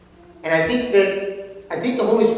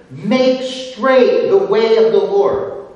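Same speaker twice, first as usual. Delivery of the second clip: proclaiming; 3 semitones higher, 7 dB louder, slower than usual. Shown as a demonstration. Proclaiming the verse's message.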